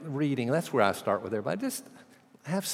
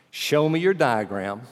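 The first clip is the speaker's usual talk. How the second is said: slow, louder challenge; grudging demand; 3 semitones higher, 7 dB louder, slower than usual. Retold or imitated. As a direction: dare him to show it.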